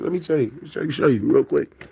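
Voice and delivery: ordinary talk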